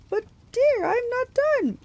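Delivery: very high falsetto protest; loud, high bridal whine; Grover. A narrator's reading. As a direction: plead like a fake bride.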